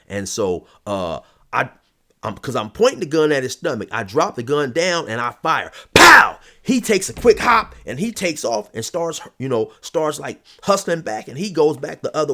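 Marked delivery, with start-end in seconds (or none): 5.9-6.4 loud, high mock gunshot burst; gunshot imitation. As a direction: bark out the shot like a pistol.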